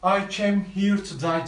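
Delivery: a desperate man's loud warning. Acted. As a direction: push him away with desperation. Romeo warns Paris.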